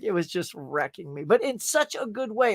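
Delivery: usual speaking voice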